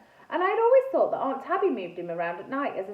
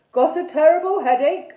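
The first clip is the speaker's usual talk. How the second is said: loud, raised ghostly complaint; booming knight ghost; 5 semitones higher, 11 dB louder than usual. A narrator's reading. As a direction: complain in a big knightly boom.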